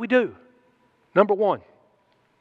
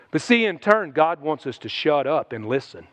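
ordinary delivery

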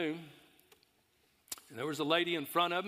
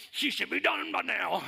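louder complaining man; grumbling big guy; 5 semitones higher, 3 dB louder than usual. Retold or imitated. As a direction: gripe like the worker in line. Impatient big customer.

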